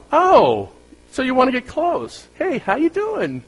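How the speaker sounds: high-pitched, drawn-out friendly greeting; mock friendly driver. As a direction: play a chummy driver in a silly high voice. Mock friendly reaction to swerving driver.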